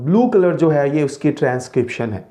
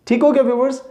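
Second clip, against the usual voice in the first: ordinary talk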